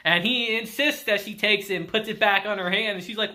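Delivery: ordinary talk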